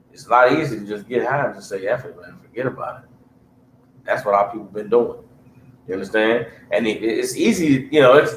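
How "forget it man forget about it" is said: a tired man waving away his family's problems; dismissive shrug. Pretend. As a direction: lower and much softer, brushing it all off. Voicing someone giving up.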